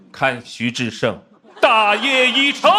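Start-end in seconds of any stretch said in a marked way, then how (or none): 1.6-2.7 loud, high mock-heroic declaration; triumphant proclamation. Comic caps his own grand quote, triumphant proclamation. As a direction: proclaim victory at the top of your voice.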